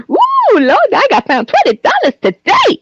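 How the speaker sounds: retelling the lady who found the fake twenty, whooping; imitating the excited lady. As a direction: hoot it out like a thrilled churchgoing lady. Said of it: "She literally just like".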